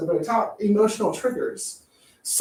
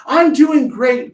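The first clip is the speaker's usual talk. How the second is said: forced cheer; 4 semitones higher, 9 dB louder, slower than usual. Retold or imitated. Forced cheerful reply quote.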